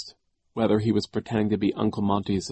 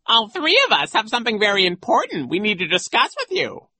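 high, loud, sneering echo; mocking singsong far above your usual pitch; nasty mimicry; 14 semitones higher, 6 dB louder than usual. A narrator's reading. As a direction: parrot the child's words back nastily. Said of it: "replied in his usual nasty way".

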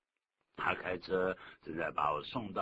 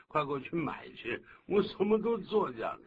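high, louder mock griping; hammed-up exasperation, pitch climbing; exasperated complaining; 8 semitones higher, 5 dB louder than usual. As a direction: act out a worker's fed-up rant. Acted complaint about a demanding boss.

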